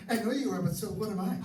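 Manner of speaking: playground taunt. Acted. Mocking a spouse the way kids argue, high sing-song taunt. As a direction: tease like a child on the playground. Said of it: "We learn how to argue as kids".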